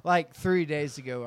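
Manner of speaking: ordinary talk, nothing marked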